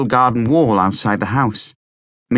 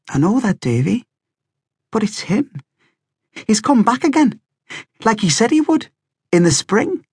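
put-on high-pitched, eager boy's insistence; 11 semitones higher than usual. A narrator's reading. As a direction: insist it's true, voice lifted high. Slog insists his dad is back.

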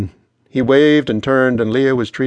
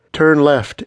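crisp, raised GPS voice; higher, louder navigation prompt; intoning GPS; 5 semitones higher, 5 dB louder than usual. A narrator's reading. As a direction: intone it like a phone giving directions. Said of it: "it intoned".

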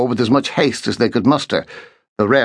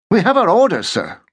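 put-on high piping young midshipman; piped reply; 9 semitones higher than usual. A narrator's reading. Midshipman answers his lieutenant, high and piping. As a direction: pipe it up, young and stiff. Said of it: "piped the midshipman".

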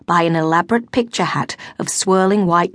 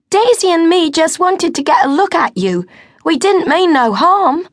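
put-on high, loud girlish plea; cheeky working-class girl pleading; 10 semitones higher, 5 dB louder than usual. A narrator's reading. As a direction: win them over with a bright appeal. Amy pleads with the nobles.

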